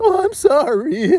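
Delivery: high, drawn-out mock-crying apology; hammed-up tearful sorry, very slow; fake emotion. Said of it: "They may show fake emotion".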